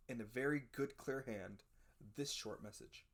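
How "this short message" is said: usual speaking voice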